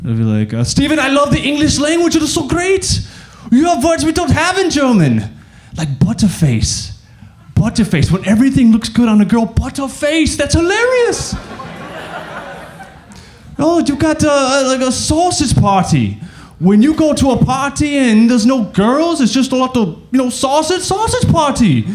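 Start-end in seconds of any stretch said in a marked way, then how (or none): 0.6-11.3 high-pitched, delighted mock-Austrian voice; Austrian accent. Austrian friend gushing to Steven about English, high Austrian accent. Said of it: "hung out with these Austrians". 13.6-21.9 high mock-Austrian voice, amused by slang; Austrian accent. Austrian friend explaining slang to Steven, high Austrian accent. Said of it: "hung out with these Austrians".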